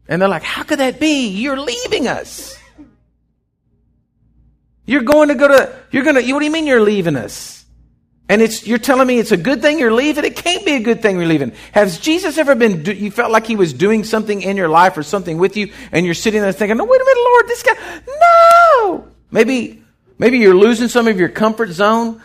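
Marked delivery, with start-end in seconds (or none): 0.4-11.5 higher, incredulous protest; disbelieving disciples. Retold or imitated. Disciples protest Jesus leaving. 16.8-19.1 much higher, crying out no; panicked pleading cry. Retold or imitated. Believer pleading against God's change.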